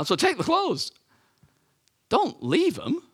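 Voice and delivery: very high-pitched comic exasperation; mock incredulous. Preacher advising would-be body snatchers, mock incredulous. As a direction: voice shooting up as if stating the obvious.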